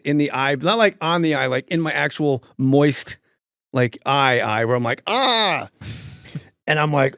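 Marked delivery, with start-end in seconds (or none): 5.1-5.7 sharp high yelp of pain. Retold or imitated. Yelping on stage after ice hits his eye.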